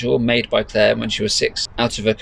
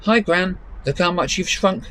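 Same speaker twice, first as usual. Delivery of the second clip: put-on higher girl's voice, cheeky; teasing Claire; 9 semitones higher than usual. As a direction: granddaughter teasing her small grandmother. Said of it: "smiled Claire".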